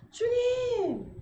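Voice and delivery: slow, high drawn-out cry; urgent call. Shown as a demonstration. Preacher showing how to call the Lord first, drawn-out cry. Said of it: "앞에 먼저 불러보세요".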